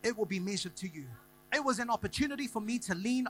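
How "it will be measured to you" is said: rising preaching. Acted. Pastor lifting the church to a crescendo. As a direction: preach it up to a peak.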